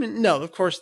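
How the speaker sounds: ordinary talk, nothing marked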